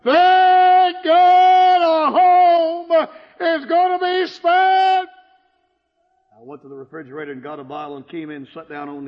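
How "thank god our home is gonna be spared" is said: high, loud, slow joyful wail; wife crying out with joy; overjoyed cry. Retold or imitated. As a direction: cry out in relief. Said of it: "threw her arms around me and said".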